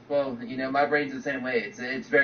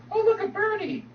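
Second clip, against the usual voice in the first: distracted squeal. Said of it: "it's like".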